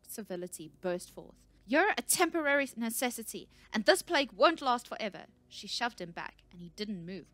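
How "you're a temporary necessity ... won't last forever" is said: high, loud fury; unstoppable tirade. A narrator's reading. Researcher's frustration bursts forth at the scout, furious tirade. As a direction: let the pent-up frustration burst out. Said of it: "The words wouldn't stop".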